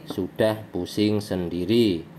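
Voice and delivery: ordinary delivery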